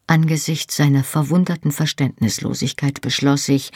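ordinary delivery

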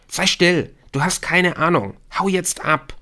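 high, agitated order to get lost. A narrator's reading. Cornered Rashid tells Emily to go away, agitated.

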